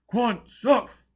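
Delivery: very high, very loud crowd bellow. A narrator's reading. Crowd bellowing approval of Joseph's words. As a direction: bellow it like a frenzied mob. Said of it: "the crowd bellowed".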